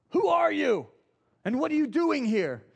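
high, loud shouted challenge in the dark; soldier's yell. Retold or imitated. Soldier yelling at the wandering rabbi, loud and high. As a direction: bark it out like a guard catching an intruder. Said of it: "he hears this voice yell out to him".

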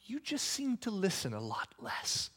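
soft, hushed voice of God; God whispering. Retold or imitated. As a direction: murmur it gently as God. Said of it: "he whispers to me and goes".

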